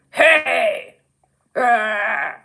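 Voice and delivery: put-on greeting, then a drawn-out growl; imagined exaggerated group voice. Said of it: "but lesbians aren't like".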